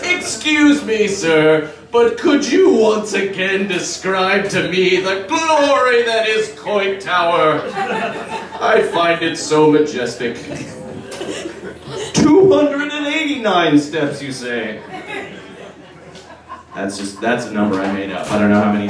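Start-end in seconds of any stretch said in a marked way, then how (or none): 0.0-10.4 high, slow, prissy request; posh blind tourist. Pretend. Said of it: "they're not standing on the corner like". 12.1-14.2 high, slow, prissy request; posh blind tourist. Pretend.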